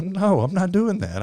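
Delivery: mock-whiny inner protest to the Lord; stubborn inner refusal. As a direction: dig in your heels, high and slow. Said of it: "in my spirit".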